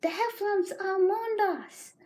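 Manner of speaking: high, alarmed Rabbit voice; horrified cry. Acted. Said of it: "Then he found his voice".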